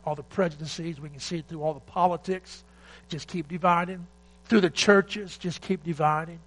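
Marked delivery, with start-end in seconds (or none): 3.1-6.4 forceful preaching. Real, not acted. Preaching against division.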